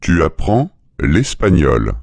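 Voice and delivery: normal talking voice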